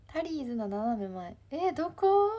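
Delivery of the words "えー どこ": loud, high puzzled exclamation; surprised question. Real, not acted. Streamer hearing where the machine is, surprised. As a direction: ask loudly, pitch jumping up in surprise.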